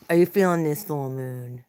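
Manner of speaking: usual speaking voice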